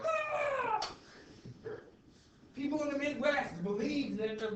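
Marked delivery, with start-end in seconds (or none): 0.0-0.9 very high squeal of fright; mock panicked scream. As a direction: shriek like a scared crowd. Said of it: "people are like".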